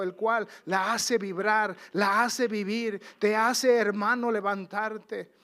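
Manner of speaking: loud, slowing preacher's climax; full-force revival preaching; fiery preaching. Acted. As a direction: build the congregation up, stretching the words. Preaching climax, call and response.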